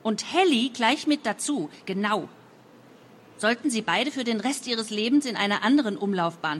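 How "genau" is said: ordinary delivery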